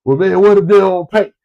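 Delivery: ordinary delivery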